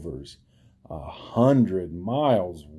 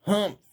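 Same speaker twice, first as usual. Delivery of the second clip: humph; 11 semitones higher than usual. A narrator's reading. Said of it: "humphed a voice".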